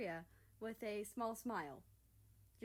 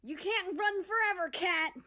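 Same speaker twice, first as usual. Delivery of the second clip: high, loud playful yell; chase shout; 11 semitones higher, 12 dB louder than usual. Acted. America shouting at the fleeing thief. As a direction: call after her as you run.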